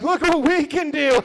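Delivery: loud, high, smug show-off; mock bragging runner; smug mock boast. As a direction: brag like a proud marathon runner.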